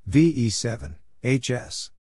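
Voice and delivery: ordinary talk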